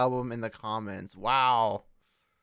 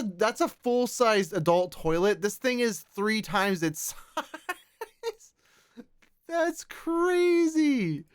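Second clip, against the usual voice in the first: amazed; 8 semitones higher, much slower than usual. Real, not acted. Amazed at giant fish photo.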